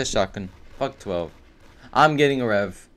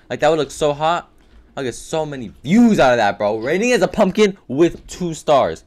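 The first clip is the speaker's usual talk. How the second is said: usual speaking voice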